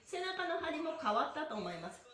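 ordinary talk

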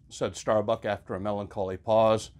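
ordinary delivery

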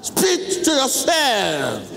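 high, very slow and drawn-out guiding voice; slow meditation guidance. Acted. Pastor guides the congregation into meditation, high and very slow. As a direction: stretch each phrase to lead the congregation inward.

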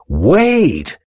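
high, excited call without much volume; shouted excitedly. A narrator's reading. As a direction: call out high to hold someone back. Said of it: "he shouted excitedly".

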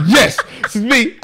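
high, slow, laughing confirmation; emphatic and high through laughter; all-caps reply. Retold or imitated. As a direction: act out a frantic text in capitals. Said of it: "in, like, capital so quickly".

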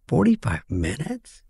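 soft, slow, stunned question; mock dismay. Comic reacting to a college asking for forty-five minutes, soft and slow. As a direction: gasp it out in disbelief. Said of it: "I'm like".

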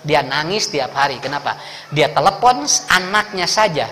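normal talking voice

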